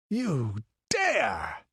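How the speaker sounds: sharp cry of outrage; indignant outburst. A narrator's reading. The count, outraged at the buccaneer's demand, indignant cry. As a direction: fling it at him, outraged. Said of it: "ejaculated Valenzo".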